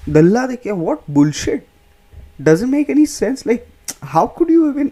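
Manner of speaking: high-pitched comic disbelief; incredulous protest. Acted. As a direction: protest the absurdity, pitch leaping up. Comedian protests the absurd insult.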